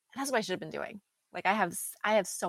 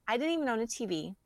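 ordinary delivery

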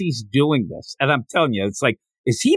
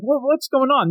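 high, bewildered question; 10 semitones higher than usual. Retold or imitated. Girl baffled at being served, high and bewildered. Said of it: "And she's like".

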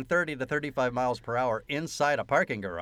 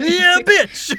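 high, loud whooping shout; excited yell; 14 dB louder than usual. Real, not acted. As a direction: yell it like cheering at the screen. Cheering a movie moment.

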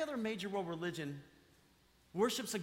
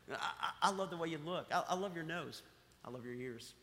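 tender God voice; 4 semitones lower, 5 dB quieter than usual. Retold or imitated. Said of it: "He's like".

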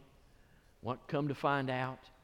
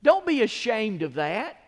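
higher, louder and slow; forceful preaching; 9 semitones higher, 11 dB louder than usual. Real, not acted. Pastor telling believers not to be ashamed, forceful. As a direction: hammer each word home.

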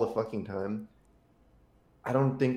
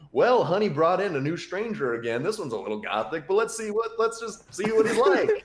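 clueless dad voice; 8 semitones higher, 4 dB louder than usual. Retold or imitated. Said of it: "the fucking clueless dad is just like".

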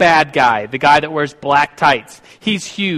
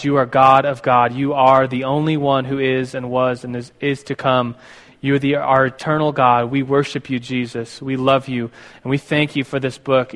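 lower, hushed prayer voice; 4 semitones lower than usual. Acted. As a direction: pray it low and earnest. Pastor leads congregation in prayer.

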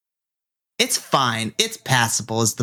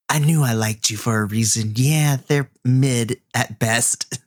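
ordinary talk

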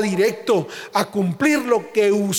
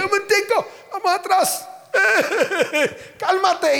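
high mock calming; 11 semitones higher than usual. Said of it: "Y yo le dije".

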